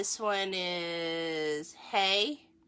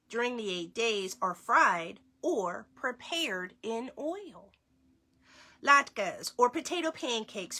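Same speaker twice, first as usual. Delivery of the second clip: lively read-aloud, slightly higher; reading to children; 4 semitones higher than usual. Acted. Parent reading a Hanukkah book to children, lively read-aloud. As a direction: read the book brightly to the kids.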